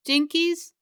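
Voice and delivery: high, loud cartoon exclamation; Scooby. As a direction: pitch it up like a mock cartoon catchphrase. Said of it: "worst Scooby impression".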